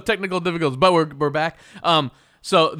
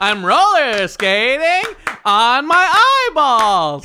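loud, high, drawn-out singer impression; 10 semitones higher, 9 dB louder, much slower than usual. Player improvising an answer in the game, hollered impression. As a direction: holler it slowly in rhythm. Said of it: "an impersonation of [name]".

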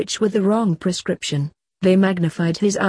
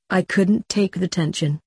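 plain reading voice

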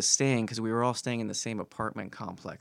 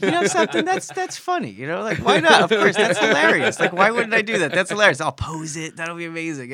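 high, loud, excited rationalizing; giddy self-persuasion; 8 semitones higher, 7 dB louder than usual. Retold or imitated. Actor convincing himself the doorstep prank is hilarious, giddy and excited. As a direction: talk yourself eagerly into a silly prank.

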